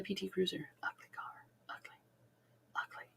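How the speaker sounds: whispered aside; whispering. Real, not acted. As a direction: drop to a whisper. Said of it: "whispered aside about the car".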